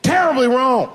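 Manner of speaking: high, loud mock alarm; alarmed outburst. Father telling of son's call, alarmed shout. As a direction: burst out with exaggerated dread.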